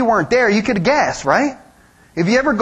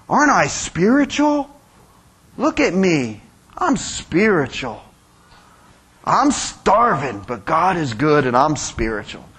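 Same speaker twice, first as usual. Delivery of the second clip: droop the words like a show-off faster; mopey fake-pious hypocrite; 6 semitones lower than usual. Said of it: "make themselves look dirty, hang their head".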